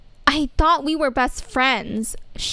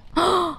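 shocked gasp; 4 semitones higher than usual. Acted. Told she failed her quiz.

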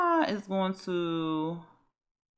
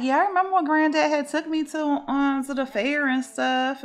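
put-on light, quick, higher girlish voice; a granddaughter happily recalling her granddad, higher; fond granddaughter; 8 semitones higher, 3 dB louder than usual. Granddaughter reminisces to grandma about granddad, in a higher quick voice. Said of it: "She like".

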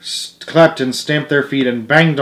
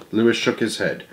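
plain reading voice

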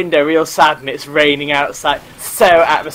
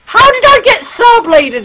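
high, loud yell of disbelief; loud, high squawk of complaint; indignant outcry; 6 dB louder than usual. Real, not acted. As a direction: yell it in high-pitched protest. Protesting his death in game.

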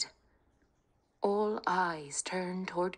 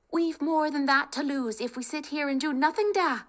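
put-on higher, urgent young Kate; rally the family, high and eager; spirited young woman; 10 semitones higher, 5 dB louder than usual. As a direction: bold daughter talking her family round. Kate voiced, pleading.

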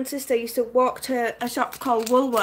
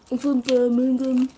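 Birmingham accent. Shown as a demonstration. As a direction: show off a Brummie accent. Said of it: "You couldn't do my accent".

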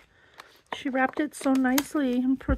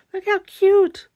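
high, loud burst of delight; excited squeal; 8 semitones higher, 8 dB louder than usual. Real, not acted. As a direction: gush over the little boxes. Unboxing cute gift boxes.

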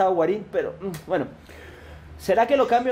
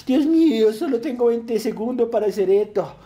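high, loud time-pressure outburst; flustered panic; 5 semitones higher, 3 dB louder than usual. Real, not acted. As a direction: fret against a ticking countdown. Panics at short countdown.

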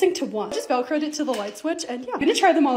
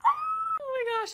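very high, soft, drawn-out squeal; awed squeal; 6 dB quieter, much slower than usual. Real, not acted. Creator seeing the lights on her cup shelves, awed squeal. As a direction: stretch it thin and high in delight. Sees the finished lit shelves.

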